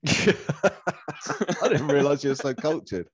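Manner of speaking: slow, laughing mock awe; laughing tease. Real, not acted. To a friend bragging about new wine, teasing through laughter.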